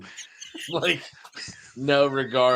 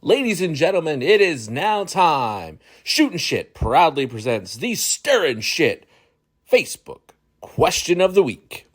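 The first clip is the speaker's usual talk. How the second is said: ring announcer; 3 semitones higher, 3 dB louder than usual. Pretend. Announces the show's question segment.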